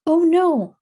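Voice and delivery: high, loud "Oh no" in mock dismay; dismayed exclamation. Shown as a demonstration. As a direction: exclaim as if something just went wrong. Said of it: "to indicate strong feelings".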